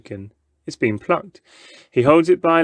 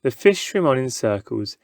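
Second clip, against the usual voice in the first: plain reading voice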